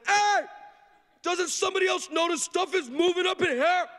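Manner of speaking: high, loud and panicky; panicked yelp. Retold or imitated. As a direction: burst out in alarm. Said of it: "finally I said".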